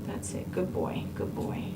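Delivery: very soft, soothing murmur; hushed praise. Real, not acted. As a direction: praise the dog almost under your breath. Quietly praising the calm dog.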